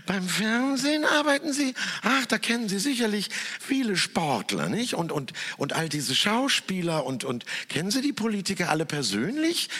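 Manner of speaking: a gushing society hostess, all delight; beaming hostess. Retold or imitated. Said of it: "strahlte mich die ... Gastgeberin".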